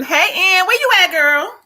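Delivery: high-pitched sing-out greeting; calling out. Real, not acted. Host looking for Ann in the live chat, calling out. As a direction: holler playfully for a friend.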